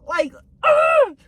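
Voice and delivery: long high-pitched groan of dismay; high, drawn-out ugh of frustration; exasperated ugh. Retold or imitated. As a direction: groan it out like you can't believe it. Storyteller groans at firing memory.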